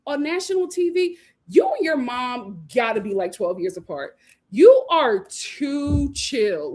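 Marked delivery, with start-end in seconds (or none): 4.5-6.7 slow scolding. Real, not acted. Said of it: "scolding contestant".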